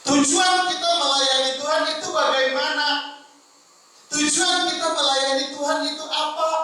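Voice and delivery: high, loud sermon voice, pressing rhetorical questions; forceful preaching. Acted. Preacher challenging the congregation's motives for serving God, forceful preaching. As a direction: preach it high and hard, drawing out the last phrases.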